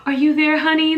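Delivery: high, loud worried call of a nurse; a nurse calling out to a fading patient in alarm. Retold or imitated. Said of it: "She's like".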